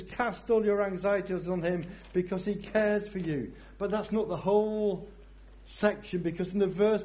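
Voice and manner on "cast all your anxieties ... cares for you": higher, brighter reading; exuberant. Shown as a demonstration. Pastor joyfully proclaiming the verse to the church. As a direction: proclaim it with joy. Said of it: "it says".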